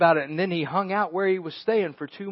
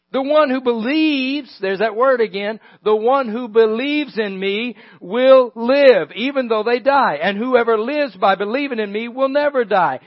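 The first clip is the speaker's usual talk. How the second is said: preaching Jesus' words; 4 semitones higher, 6 dB louder than usual. Acted.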